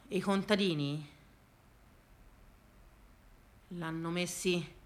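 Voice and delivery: low, soft, resigned telling; hushed and downcast; subdued lament. Acted. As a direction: lament it quietly to grandpa, pitched low. Mournful account of lost farms.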